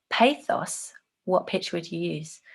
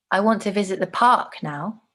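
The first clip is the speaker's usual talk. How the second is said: "park" made the strongest word; stress on PARK; 2 semitones higher, 3 dB louder than usual. Shown as a demonstration. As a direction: hit "park" as the place that matters. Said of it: "say the next phrase in three different ways".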